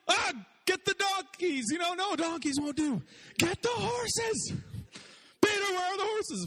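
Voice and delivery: hammed-up panic about getting there on time; stressed Jesus. Jesus yelling at Peter for horses, frantic high mock panic.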